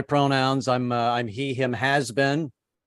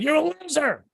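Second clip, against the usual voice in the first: very high mocking voice; wife in bed mocking her husband; taunting wife. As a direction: sneer it like a jabbing spouse. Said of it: "my wife in bed sitting next to me going".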